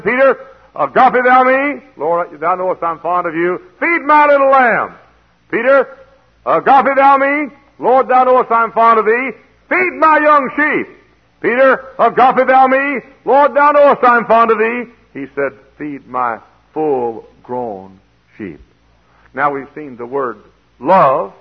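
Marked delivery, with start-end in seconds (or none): 0.0-14.8 preaching at full force. Acted. Preacher acting out Jesus questioning Peter, loud and high. 15.8-18.6 solemn slow command. Acted. Jesus's final command, drawn out.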